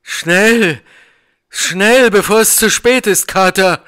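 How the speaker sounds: high, loud frightened urging; shaken by fear. A narrator's reading. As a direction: cry out in panic for haste. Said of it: "von Angst geschüttelt". (angstvoll und laut drängend)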